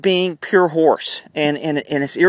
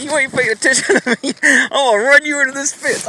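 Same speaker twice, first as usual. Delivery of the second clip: higher-pitched playful horse voice; mock horse voice; 7 semitones higher than usual. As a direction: speak for the horse, pitched up and teasing. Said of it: "He's like".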